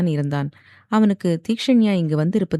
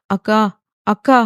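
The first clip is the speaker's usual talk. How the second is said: pleading call, pitched up and louder; urgent calling; 4 semitones higher, 5 dB louder than usual. A narrator's reading. Girl trying to rouse her stunned sister-figure, urgent calling. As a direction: call out insistently for her attention.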